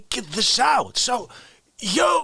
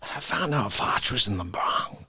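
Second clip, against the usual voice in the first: ordinary talk, nothing marked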